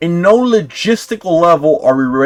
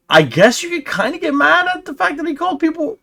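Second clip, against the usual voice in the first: high-pitched, grudging whine; mock concession; 14 semitones higher than usual. Host conceding critics could object to the word, high mock concession. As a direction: pitch way up as if humouring the offended.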